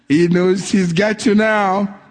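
usual speaking voice

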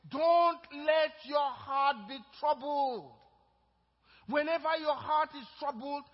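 loud, slow, rising preacher's call; full-force preaching. Acted. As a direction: preach it out to the whole room.

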